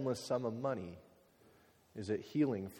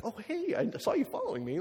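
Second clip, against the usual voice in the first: mock startled stranger; 12 semitones higher than usual.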